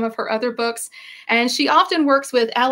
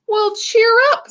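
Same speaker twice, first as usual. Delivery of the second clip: much higher, louder cheer; cheery giant; 10 semitones higher, 5 dB louder than usual. Acted. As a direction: lift the goat's spirits brightly. George cheers the goat.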